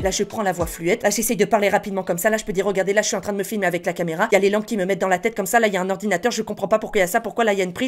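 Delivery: quick, light rambling; fast thin-voiced demo. Shown as a demonstration. Showing the fast light voice. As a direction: rattle off what you see, fast. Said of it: "je vais vous montrer".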